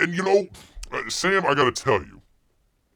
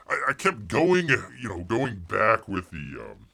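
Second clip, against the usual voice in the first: normal talking voice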